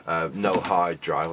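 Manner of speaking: usual speaking voice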